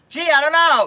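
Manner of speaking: loud, high sarcastic jab; mock sarcastic. Tarot reader teasing viewer's question with loud sarcasm. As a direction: fake a snarky answer then take it back. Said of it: "I'm just kidding".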